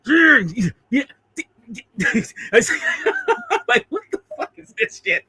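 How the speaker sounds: hammed-up high whoop then laughing; mock the kid's crazed cheer, high; high gleeful yell. Retelling the boy attacking the bully, high laughing whoop. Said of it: "he's like".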